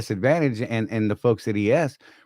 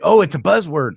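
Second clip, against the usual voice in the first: higher, louder mock objection; imitated skeptics; 6 semitones higher, 8 dB louder than usual. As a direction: pipe up like a dismissive doubter. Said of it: "a lot of people think".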